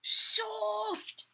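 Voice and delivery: high, loud, gleeful call; children's exclamation. A narrator's reading. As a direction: exclaim it like a delighted class. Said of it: "The children with laughter exclaimed".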